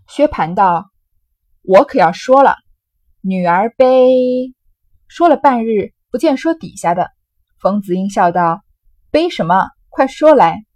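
loud, lively storytelling voice acting out a drinking game; dramatic novel reading. Teacher reads the drinking-game scene aloud, loud and lively. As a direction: read the scene with relish, loud and animated.

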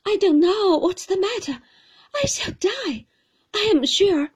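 high, loud panicky plea; frightened young mother, high and loud. Acted. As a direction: gasp it out in fear.

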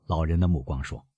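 usual speaking voice

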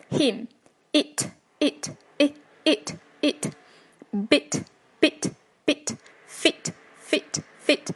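him, it, bit, fit each short and separate, with gaps; short i drill. Shown as a demonstration. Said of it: "都是短一音".

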